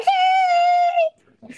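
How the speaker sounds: very high, drawn-out mock holler; retold excited call. Woman popping up behind the video, imitated high excited holler. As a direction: imitate a long high-pitched greeting.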